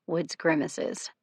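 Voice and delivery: ordinary delivery, nothing marked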